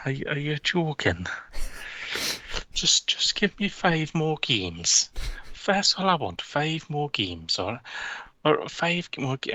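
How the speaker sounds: high, slow pleading Geordie whine; Steve Bruce impression. Steve Bruce pleading with the chairman to keep his job.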